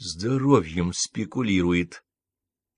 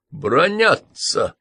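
slow indignant outburst, a touch louder; 7 semitones higher, 4 dB louder, much slower than usual. A narrator's reading. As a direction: drag it out in outrage. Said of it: "возмутился он". (возмущённо, протяжно)

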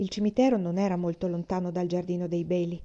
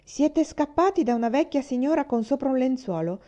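teasing; 6 semitones higher than usual. A narrator's reading. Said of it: "li prese in giro Jerry".

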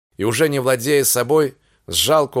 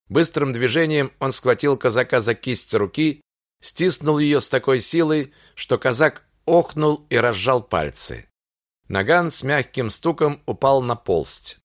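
plain reading voice

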